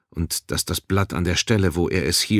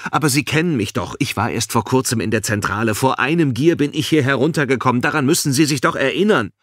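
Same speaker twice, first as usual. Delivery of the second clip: higher, agitated pleading; urgent protest; 7 semitones higher, 4 dB louder than usual. A narrator's reading. Balin insists guard knows him, urgent protest.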